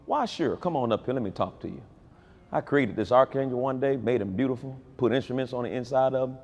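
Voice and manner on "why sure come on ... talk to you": softer, coaxing father; gentle fatherly voice. Acted. Preacher voices God calling his children near, soft and gentle. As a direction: invite them close, softly.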